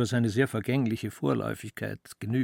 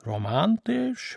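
higher and slow, a sneering drawl; mocking; 8 semitones higher, slower than usual. A narrator's reading. Elias mocking the small room with a drawn-out sneer. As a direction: sneer the word out, slowly. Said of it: "höhnte er".

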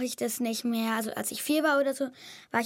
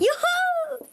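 cheering; 4 dB louder than usual. Real, not acted. Wins the game.

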